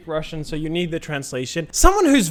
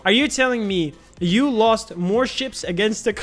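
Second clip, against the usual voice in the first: ordinary delivery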